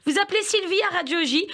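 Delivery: normal talking voice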